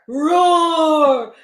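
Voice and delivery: loud, long monster roar; Yeti roar. Acted. Yeti woken from a nap, loud roar.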